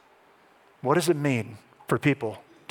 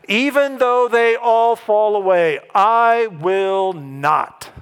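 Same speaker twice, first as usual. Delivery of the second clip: loud, high and drawn-out declaration; ringing, defiant pledge; bold vow; 6 semitones higher, 6 dB louder, much slower than usual. Acted. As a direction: vow it slowly and forcefully, weight on every word. Preacher acts out Peter's bold vow.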